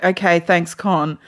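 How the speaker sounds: low, subdued imitation of a weary colleague; mock unenthusiastic acknowledgement, dropping away; annoyed. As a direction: imitate someone fed up with being called on. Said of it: "he just looked so annoyed".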